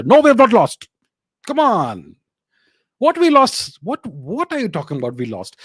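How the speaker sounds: loud, high protest; indignant outburst. Real, not acted. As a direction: fire back, scoffing at the idea. Indignant rebuttal to viewer.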